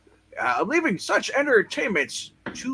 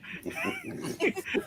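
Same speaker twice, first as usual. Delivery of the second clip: gleeful cackle; 9 dB quieter than usual. Acted. Mad scientist glee.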